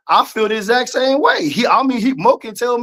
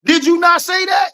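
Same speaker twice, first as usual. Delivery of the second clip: raised voice, pitch jumping up on NOT; heated demand; 6 semitones higher than usual. Real, not acted. Arguing with his co-host, raised challenging voice. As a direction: press him hard, almost shouting.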